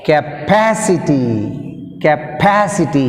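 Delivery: Capacity stretched out with stress on pa; slow model word. Shown as a demonstration. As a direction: say Capacity slowly, leaning on pa. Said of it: "Read aloud".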